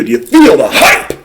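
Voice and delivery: ordinary delivery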